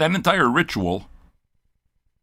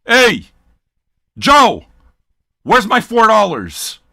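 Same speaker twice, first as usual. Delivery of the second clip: loud, high, slow call across the room; mock shout; 7 semitones higher, 7 dB louder, much slower than usual. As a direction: yell at Joe for his dues. Calling out to patron Joe.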